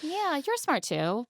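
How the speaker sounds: high-pitched patronizing imitation; singsong mock praise; condescending. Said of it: "condescendingly adds to Tara".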